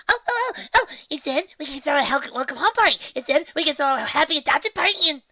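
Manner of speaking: very high, loud and bursting with excitement; bubbly Pinkie voice. Acted. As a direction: squeal out party plans nonstop. Pinkie bursting with party ideas.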